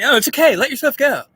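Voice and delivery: high, airy mock Biden voice; mocking Joe Biden. Biden telling people to let go, mock high coo. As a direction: coo it like a soothing pushover. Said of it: "Biden saying".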